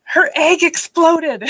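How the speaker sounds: put-on high, drawn-out child voice; mock kid announcing a disaster; imitating son. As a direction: stretch it out in a high little-boy voice. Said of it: "And, and my son says".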